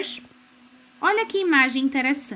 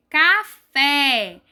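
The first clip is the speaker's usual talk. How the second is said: café split in two, louder long fé; café with a strong, held final fé; stress on FÉ; 6 dB louder than usual. Shown as a demonstration. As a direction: hit the second syllable of café hard. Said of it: "a parte mais forte de café".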